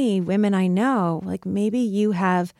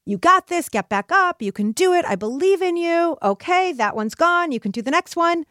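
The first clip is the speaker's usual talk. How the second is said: cheerleading coach; 8 semitones higher than usual. Retold or imitated. Coach cheering on a bowler after a bad frame, bright encouragement. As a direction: pump the bowler up after a miss. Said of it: "the coaches who said to the bowlers".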